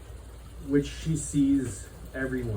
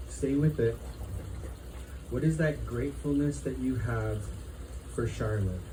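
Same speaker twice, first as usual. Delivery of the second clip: guided reflection; 3 dB quieter than usual. Acted. Leads a gratitude reflection.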